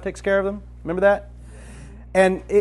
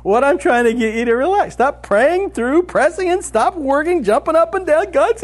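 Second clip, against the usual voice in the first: Jesus; 7 semitones higher than usual. Retold or imitated. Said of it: "best expression of this transition".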